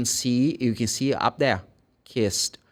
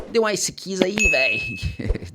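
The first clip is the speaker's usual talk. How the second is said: Bahian Portuguese slang with kiss as keess; 5 semitones higher than usual. Retold or imitated. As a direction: toss it off like a local in Salvador. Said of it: "here in Salvador, you go".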